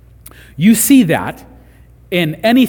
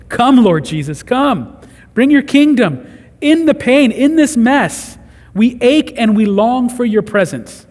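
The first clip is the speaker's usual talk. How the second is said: loud, raised-pitch cry of prayer; pleading prayer, building higher and louder; impassioned plea; 6 semitones higher, 5 dB louder than usual. Acted. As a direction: cry out to God with urgency. Crying out a prayer.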